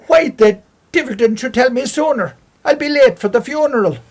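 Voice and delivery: high, loud, strange cat voice; talking cat. Acted. Cat startled to learn of a death, high and loud. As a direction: squawk it out like an indignant cat. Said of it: "looked him square in the face and said".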